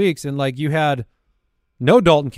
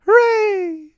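sarcastic cheer; 4 dB louder than usual. Real, not acted. Sarcastic cheer at injury news.

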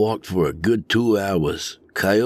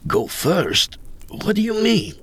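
startled question climbing much higher; baffled; 10 semitones higher than usual. A narrator's reading. Greenhorn questions Coyote's offer, baffled and high. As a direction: ask it in high-pitched disbelief.

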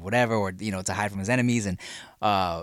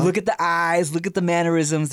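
higher, urgent insistence; imitated theorists; 6 semitones higher, 4 dB louder than usual. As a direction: mimic believers pointing out the proof. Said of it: "they're like".

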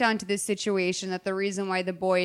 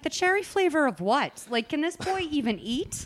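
higher-pitched incredulous rant; exasperated disbelief; 6 semitones higher than usual. Retold or imitated. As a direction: build a comic protest of bafflement. Mock outraged reaction to the scene.

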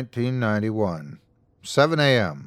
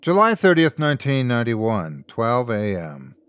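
plain reading voice

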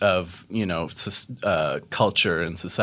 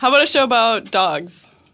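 put-on voice; 13 semitones higher, 4 dB louder than usual. Repeats pitch in odd voice.

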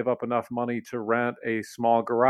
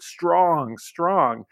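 put-on grandpa's light Italian accent, proud and emphatic; a hint of an old Italian accent, firm and proud; imitating Italian accent; 6 semitones higher, 3 dB louder than usual. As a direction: say it like an Italian grandpa banging on the wagon. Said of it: "say in this Italian accent".

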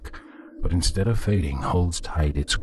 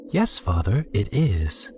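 high, perky and self-satisfied; chirpy, smug; 4 semitones higher than usual. A narrator's reading. Penitent answering the impatient priest, chirpy and smug. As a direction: answer brightly, pleased with yourself. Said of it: "I replied in a chirpy, smug voice".

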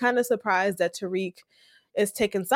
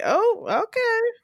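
mock surprise; 12 semitones higher, slower than usual. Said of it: "So it's like".

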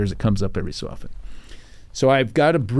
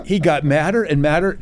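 usual speaking voice

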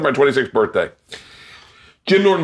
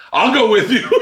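high, laughing "I'll go with you"; laughing offer; 9 semitones higher than usual. Retold or imitated. Patrice teasing the woman, laughing as he offers. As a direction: tease through a laugh. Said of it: "Patrice is like".

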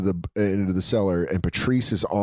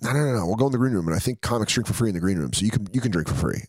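quick, eager mock reassurance; fast reassurance; faster than usual. Inviting a broke friend to the show, fast and eager. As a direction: rush to talk him into it. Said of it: "You're like".

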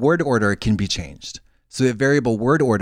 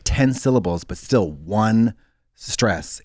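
usual speaking voice

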